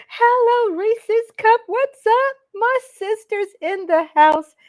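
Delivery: excited shout-out. Real, not acted. Greeting viewers by name on live.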